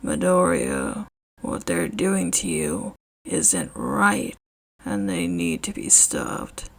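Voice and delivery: firm quiet resolve; low serious man voice. Acted. Eraserhead, serious.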